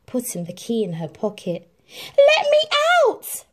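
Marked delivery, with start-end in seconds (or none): 1.9-3.4 very high, loud locked-in plea; protesting. Acted. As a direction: bang on the door and protest. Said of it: "protested Cinderella".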